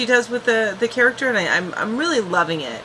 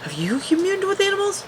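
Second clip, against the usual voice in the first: much higher, mocking the question; 12 semitones higher than usual. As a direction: squeak it in a teasing falsetto. Retold question, mocking high take.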